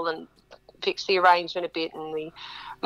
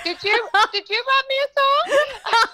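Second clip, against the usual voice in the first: much higher, laughing hopeful wife voice. Retold or imitated. Wife in the crowd asking her husband, high laughing hope. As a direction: gasp it out as a thrilled wife.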